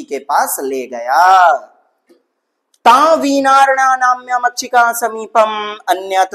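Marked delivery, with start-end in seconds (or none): 2.7-6.3 higher, louder chanted reading; teacher rereading the Sanskrit sentence for the class, recitation style. Acted. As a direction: read it out like a verse.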